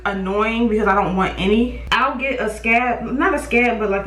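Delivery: ordinary talk, nothing marked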